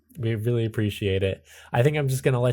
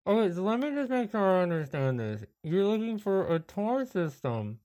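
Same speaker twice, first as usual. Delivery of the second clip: lightly higher, softer clerk; a hint of a weary phone agent; travel agent voice; 5 semitones higher, 5 dB quieter than usual. GM plays travel agency worker.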